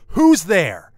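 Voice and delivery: high, loud, startled shout; panicked yell. Retold or imitated. Girlfriend shoots up out of bed at the intruder, panicked yell. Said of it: "in this panicked voice".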